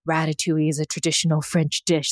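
low, soft mock Australian accent; made-up Australian accent, pitched low; sultry Australian. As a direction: imitate a chef with a sultry Australian lilt. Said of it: "Australian and sultry".

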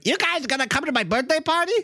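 put-on high little girl inviting friends; high Muffy voice. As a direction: chirp the invitation sweetly. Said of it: "Muffy Crosswire's like".

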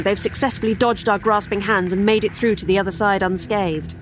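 ordinary delivery

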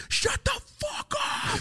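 very high, slow screaming mom. Retold or imitated. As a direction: shriek it like a furious mom.

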